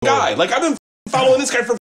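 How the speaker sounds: gushing that's my guy; proud fan. Retold or imitated. To laughing friends, proudly claiming his favorite actor.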